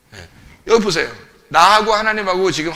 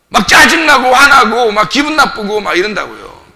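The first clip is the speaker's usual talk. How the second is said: loud, raised-pitch exhortation; forceful preaching; 5 semitones higher, 7 dB louder than usual. Real, not acted. Preacher explaining to the congregation when anger flares, forceful preaching. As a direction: drive the point home at full voice.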